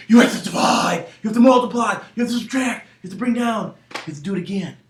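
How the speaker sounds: loud, emphatic chant easing off; insistent shouting. Acted. Sleepwalking child answering baffled parents, insistent yelling. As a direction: shout each step as an order.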